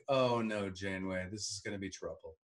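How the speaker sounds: low, very soft aside of mild dismay; understated, hushed "here we go"; retold dread. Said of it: "And I'm like".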